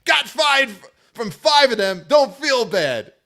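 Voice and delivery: mock-reading Jeff's comment in a high voice; Jeff's yelling voice. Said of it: "Read this in Jeff's voice".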